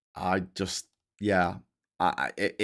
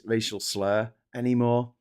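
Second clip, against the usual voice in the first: ordinary delivery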